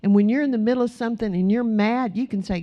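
ordinary talk

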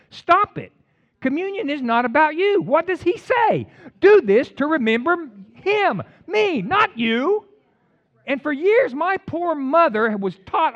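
high, loud, emphatic preaching; fired-up preaching. Acted. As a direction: preach it hard, push every line up.

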